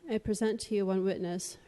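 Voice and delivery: ordinary talk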